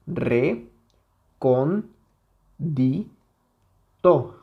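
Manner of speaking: each syllable on its own, pauses between; sílaba por sílaba. Shown as a demonstration. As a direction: break the word into separate syllables. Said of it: "La separamos en sus sílabas". (separando las sílabas)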